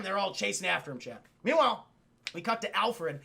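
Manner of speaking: ordinary delivery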